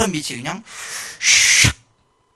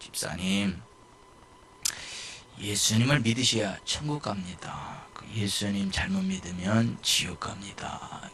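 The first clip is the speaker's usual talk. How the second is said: careful and gentle; 9 semitones lower, 7 dB quieter, slower than usual. Retold or imitated. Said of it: "조심스럽게 얘기를 했거든요".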